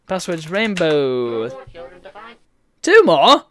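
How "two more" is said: high, loud disbelief; a sudden surprised squeak. Real, not acted. Surprised reaction to game event.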